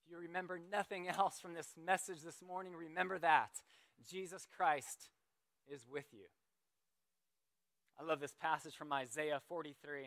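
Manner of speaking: normal talking voice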